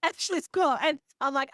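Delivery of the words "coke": high-pitched "Coke" with a short open "o"; imitated failed pronunciation. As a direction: mimic sister missing the glide in "Coke". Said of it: "she just couldn't".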